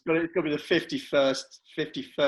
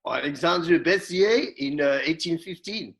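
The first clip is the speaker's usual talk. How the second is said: put-on French accent; 3 semitones higher, 3 dB louder than usual. Player performing the marshal's name on request, playful French accent. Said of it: "we want it in Dave's French".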